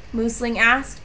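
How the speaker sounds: ordinary delivery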